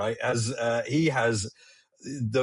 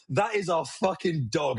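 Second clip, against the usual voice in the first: ordinary talk, nothing marked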